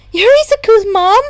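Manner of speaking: put-on high, loud, bubbly girl voice; a bouncy girl bursting with joy; excited girl. A narrator's reading. Excited girl meets Izuku's mom.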